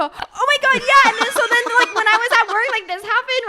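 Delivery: high, loud, gushing voice; excited storytelling. Retold or imitated. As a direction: gush like you're mid-story. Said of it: "I go".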